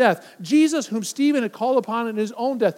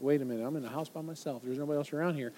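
nervous hush; 7 semitones lower, 10 dB quieter, faster than usual. Acted. Imagined frightened listener.

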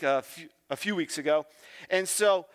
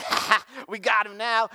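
higher mock gloat, ha-ha and all; smug gloating; 3 semitones higher than usual. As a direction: gloat like you've trapped him. Crowd gloating at Jesus.

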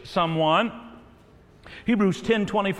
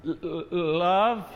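slow, stuck on the first sound; halting stammer; slower than usual. Acted. Pastor playing men who find it hard to say, halting stammer. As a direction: stammer on the L before getting love out.

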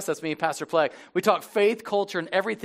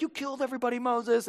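accusing people, imitated; 7 semitones higher, 3 dB quieter than usual. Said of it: "'cause they're like".

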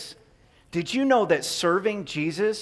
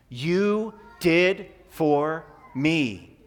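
slow, drawn-out call-and-response; broad singsong kids theatre; much slower than usual. Shown as a demonstration. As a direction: stretch each word out for the kids to echo. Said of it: "can you say that with me".

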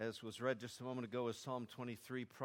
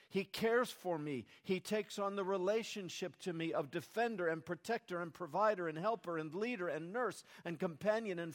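rising, louder sermon climb, higher pitch; preaching at full force; 7 semitones higher, 4 dB louder than usual. Acted. Pastor proclaiming God's care to the congregation, fervent. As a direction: build it up like a pulpit climax.

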